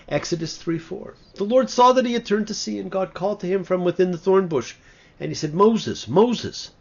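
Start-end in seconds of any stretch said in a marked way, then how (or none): 1.3-6.7 loud, high proclaiming of the verse; declaiming scripture. Acted. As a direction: ring out the angel's call from heaven.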